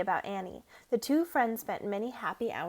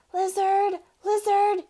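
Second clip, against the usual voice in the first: calling out; 9 semitones higher, 8 dB louder, slower than usual. A narrator's reading. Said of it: "he whispered".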